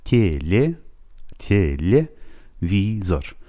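"تلویزور" very slow, as tye-lye, vii-zor; syllable-by-syllable pronunciation. Shown as a demonstration. Teacher modelling the word for learners, drawn-out syllables. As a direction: stretch "تلویزور" into separate soft syllables.

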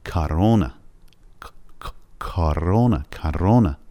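کرونا with the ک said from the back of the throat and the tongue off the palate; Russian k. Shown as a demonstration. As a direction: repeat کرونا with an open-mouthed back ک. (کرونا با ک روسی و دهان باز)

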